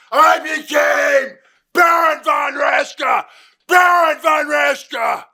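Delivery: high, loud, booming German heel voice; shouted manic German persona; German villain persona. Shown as a demonstration. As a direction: unleash the alter ego with a roar. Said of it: "My alter ego came out".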